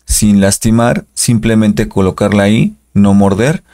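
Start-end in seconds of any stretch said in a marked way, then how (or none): none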